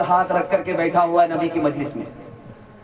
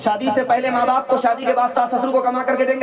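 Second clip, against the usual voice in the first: rally the gathering to pledge; rousing preaching; 6 semitones higher, faster than usual. Acted. Rallying congregation to raise hands. (ऊँची आवाज़ में जोशीला आह्वान)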